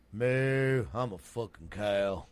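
put-on slow, regular old Texan moo; lightly voiced Texas-accented cow. Doing regional cows, plain slow Texan cow. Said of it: "Texas would be just regular old".

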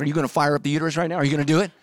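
rushed, fast teasing with a laugh; laughing tease. Acted. Preacher imagining himself teasing God, rushed and laughing. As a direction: poke fun at God through a laugh.

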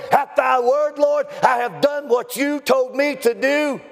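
high, ringing preacher's shout; full-force preaching. Real, not acted. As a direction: preach it high and forceful, driving every phrase.

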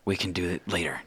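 put-on soft, whispery child; quick secret half-whisper; hushed aside. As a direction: murmur it before anyone hears. Secret put off as someone approaches.

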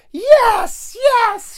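very high, loud shrieking yes; ecstatic cheer. Retold or imitated. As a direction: squeal it out like a thrilled friend. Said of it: "She's just like".

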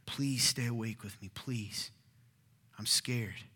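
soft, low pleading with friends; subdued scared appeal; frightened, lonely plea. Acted. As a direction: beg quietly, voice dropping away. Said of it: "He is frightened".

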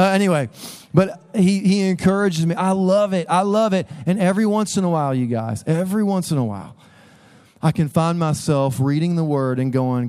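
usual speaking voice

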